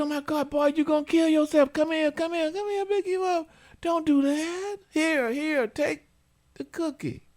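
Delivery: put-on high, fussing mother scooping up her child; mock fussing mom. Mother rushing to her hurt toddler, high fussing voice. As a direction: coo and fuss over the boy, high.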